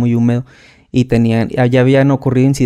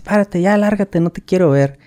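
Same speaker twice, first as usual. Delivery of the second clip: higher-pitched mock order to leave; imitated dismissal; 6 semitones higher than usual. Sergeant sends the recruit away, mock dismissive. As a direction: wave the recruit off like a fed-up sergeant.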